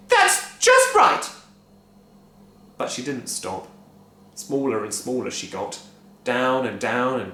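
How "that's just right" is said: high, loud cry. A narrator's reading. Wife crying out to the shrinking Grandma. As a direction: cry out to stop it. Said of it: "cried Missus Cranky".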